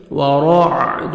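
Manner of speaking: slow, drawn-out melodic tarteel; Quran recitation. Shown as a demonstration. Tajweed teacher reciting a verse for students, melodic and slow. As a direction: chant each syllable, stretching the vowels.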